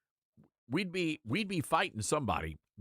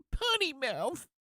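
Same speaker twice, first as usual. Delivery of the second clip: even higher prim tut-tut on "Potty mouth"; mock scolding repeat; 12 semitones higher, slower than usual. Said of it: "Sorry. I just got worked up".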